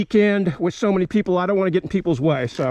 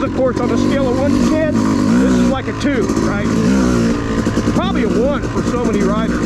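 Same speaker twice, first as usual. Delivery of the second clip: over engine noise; 4 dB louder than usual. Real, not acted. Talking while riding the dirt bike.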